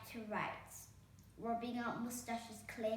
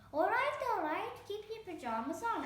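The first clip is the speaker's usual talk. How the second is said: exasperated fairy godmother; 10 semitones higher, 6 dB louder than usual. A narrator's reading.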